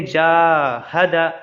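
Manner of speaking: जाहदा with the जा held long and दा short; जाहदा said very slowly, long first vowel; drawn-out first syllable. Shown as a demonstration. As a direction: stretch the जा in जाहदा, then clip दा. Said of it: "जल्दी पढ़ेंगे दोनों को".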